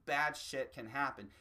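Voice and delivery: normal talking voice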